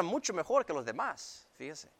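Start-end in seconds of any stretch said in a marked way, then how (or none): none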